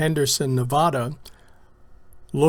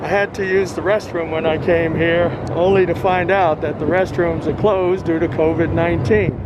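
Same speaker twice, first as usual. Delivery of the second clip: muffled through mask. Real, not acted. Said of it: "Now, I'm talking through a mask".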